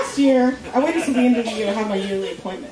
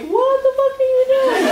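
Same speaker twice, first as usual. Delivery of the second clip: baffled doctor; 11 semitones higher than usual. Retold or imitated. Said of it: "He's looking at me like".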